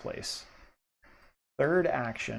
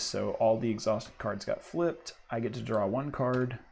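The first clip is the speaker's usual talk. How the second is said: ordinary delivery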